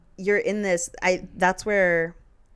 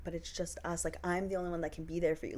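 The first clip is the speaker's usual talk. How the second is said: mock manipulative partner; 12 dB quieter than usual.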